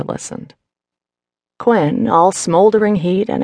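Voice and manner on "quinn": ordinary delivery, nothing marked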